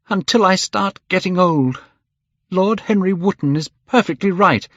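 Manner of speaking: high, loud bitter outburst; angry. A narrator's reading. Dorian lashing out at the painter, high and loud. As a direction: hurl it in jealous anger. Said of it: "he seemed quite angry".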